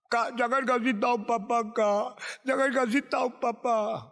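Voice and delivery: put-on high, whiny little-kid begging; acted childlike plea; frightened pleading. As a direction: plead with big brother not to tell. Younger sibling pleads with brother.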